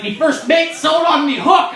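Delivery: high, very loud pirate shout. Acted. Pirate explaining who fitted his hook, loud pirate voice. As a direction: belt it out as a pirate. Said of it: "he's like".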